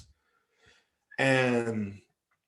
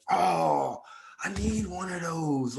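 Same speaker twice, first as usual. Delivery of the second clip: higher-pitched imitated admiration; mock-pleading, pitched up; eager wanting; 6 semitones higher, slower than usual. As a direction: imitate a friend coveting something. Friend coveting his cap, retold.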